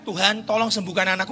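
softly spoken, contained prayer; restrained plea. Shown as a demonstration. Preacher showing how the mother did not pray, restrained plea. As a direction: pray it politely, without any shouting. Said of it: "itu bukan".

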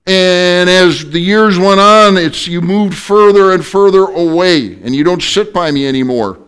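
high, loud, whiny wife voice; wife scolding husband from across the car; complaining wife. Retold or imitated. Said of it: "She said".

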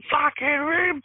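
sudden sharp pitch spike, cut off mid-word; going ballistic. Retold or imitated. Losing it at the apprentice who tangled the wires, a high outburst. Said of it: "I am going ballistic at this point".

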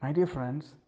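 ordinary talk, nothing marked

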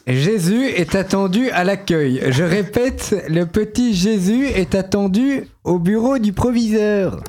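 slow, high loudspeaker announcement voice; mock PA announcement. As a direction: announce it slowly over the school tannoy. School loudspeaker announcement parody.

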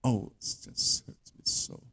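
slow, soft, voiceless whimper of a weakling; mock suffering. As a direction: barely get it out, hamming up the pain. Mocked weakling complaining of pain.

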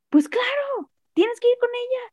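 very high-pitched retold exclamation; emphatic encouragement. Retells partner's emphatic supportive reaction.

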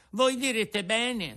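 put-on high, fast boyish puppet voice; a hungry puppet pouring out his story; puppet Pinocchio. Pinocchio telling Geppetto his hunger woes, high puppet voice.

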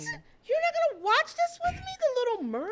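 very high-pitched mock incredulity; retold disbelief. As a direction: squeal it like a scandalized mom. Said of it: "I'm like".

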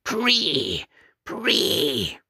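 high, shrill-pitched animal cry; wild cry. Acted. Mute on the rafter cries out as he swings, high wild cry. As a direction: cry out like a broken madman. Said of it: "he cried".

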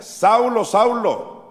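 loud, high, ringing call of Saulo's name; voice from heaven. Acted. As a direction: call out twice, each name falling away. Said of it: "oyó una voz que le decía".

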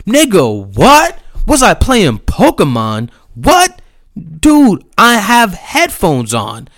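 very high, loud incredulous rant; pissed-off outburst. Retold or imitated. Skater reliving his comeback to the husband, outraged and yelling. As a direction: explode in disbelief, pitch leaping on each question. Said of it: "this man pissed me off".

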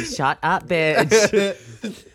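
put-on half-laughing jab at the girl; laughing mock insult. Laughing jab at the date's friend.

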